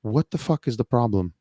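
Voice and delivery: ordinary delivery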